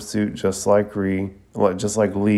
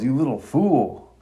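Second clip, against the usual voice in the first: mild mock yell, pitched up; retold yell; 5 semitones higher than usual. A narrator's reading. Guy scolding Lee as he punches him, mock yelling. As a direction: scold in a raised pitch, not full volume. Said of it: "he yells".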